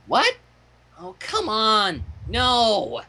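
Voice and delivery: mocking high scoff; scornful Pinkie. Pinkie scoffing at the slime monster idea, lightly voiced. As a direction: scoff as if the idea were beneath her. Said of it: "The scorn in her voice".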